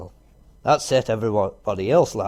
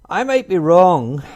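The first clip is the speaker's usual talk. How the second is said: timid squirrel; 7 semitones higher, 8 dB louder than usual. Acted. Squirrel hesitant suggestion.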